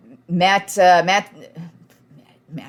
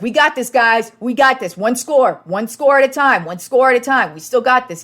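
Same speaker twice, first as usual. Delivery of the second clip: higher and louder, rallying the team; pumped-up sideline encouragement; 6 semitones higher, 4 dB louder than usual. Retold or imitated. Brady rallying teammates on the sideline, pumped up. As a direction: fire up the guys, one score at a time. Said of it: "this dude's walking up and down the sideline like".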